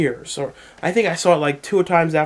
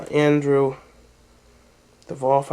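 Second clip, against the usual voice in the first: usual speaking voice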